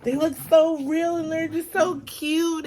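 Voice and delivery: gushing aunt. Retold or imitated. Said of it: "My aunt was like".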